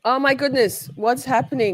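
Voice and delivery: high-pitched, bewildered exclamation; real flustered disbelief. Real, not acted. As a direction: wail it, baffled by the glitch. Video keeps looping, she reacts.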